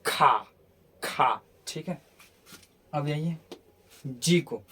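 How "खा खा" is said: "खा" with silent final r; low, open "खा" with no r; British pronunciation. Shown as a demonstration. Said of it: "इसको pronounce नहीं किया जाता". ("खा" नीचे सुर में, बिना र)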